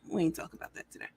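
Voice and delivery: fast whispered aside, cutting the topic off; hushed aside. Real, not acted. To the chat, whispering she won't discuss court today. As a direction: whisper it quickly, dropping the subject.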